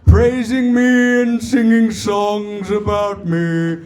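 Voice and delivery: slow, drawn-out booming divine voice; traditional God. Acted. God welcoming a soul to heaven, slow grand intoning. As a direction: intone grandly, stretching each phrase. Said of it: "You know, traditional heaven".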